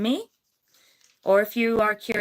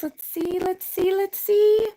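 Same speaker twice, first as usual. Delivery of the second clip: building excitement; 8 semitones higher than usual. Real, not acted. Building suspense of the wheel.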